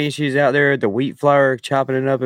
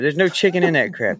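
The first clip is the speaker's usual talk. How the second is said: normal talking voice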